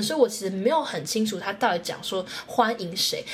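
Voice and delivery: ordinary talk, nothing marked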